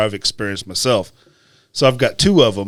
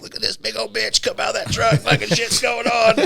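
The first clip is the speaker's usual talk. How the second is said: mock trucker on radio; 10 semitones higher than usual. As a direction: imitate rowdy truckers jeering on the CB.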